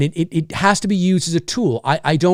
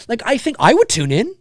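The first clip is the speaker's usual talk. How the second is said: ordinary delivery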